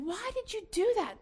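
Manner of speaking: high-pitched mock exasperation; retold mad scolding. Mother retelling confronting her four-year-old son, mock angry. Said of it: "I was so mad".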